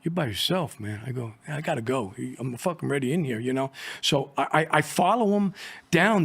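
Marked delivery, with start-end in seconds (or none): 0.0-3.7 softer, under his breath, talking himself up; a quiet pep talk to himself before going in; muttering to himself. Retold or imitated. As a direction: undercover agent psyching himself up on the stairs, muttered softly. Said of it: "I'm saying to myself".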